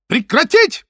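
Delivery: high, loud squealed order; shrill shriek. Acted. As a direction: shriek to stop it. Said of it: "взвизгнул он". (визгливый крик)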